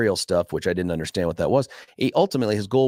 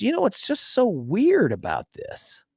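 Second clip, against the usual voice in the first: mock innocent teacher; 12 semitones higher, slower than usual. Teacher springs the trap, retold.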